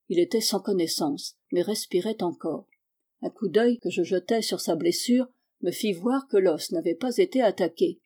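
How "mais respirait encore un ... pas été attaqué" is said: ordinary delivery, nothing marked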